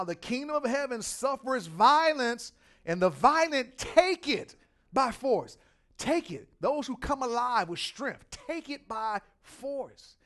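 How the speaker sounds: loud, slow proclaimed verse; preacher reading Matthew with slow force; emphatic scripture reading. Acted. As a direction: lean on every word of the verse.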